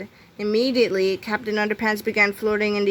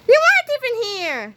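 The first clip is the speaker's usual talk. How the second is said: high, loud squawk of objection; outraged protest; 13 semitones higher, 5 dB louder than usual. A narrator's reading. George exposing Melvin's lie, loudly outraged. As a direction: burst in at a much higher pitch.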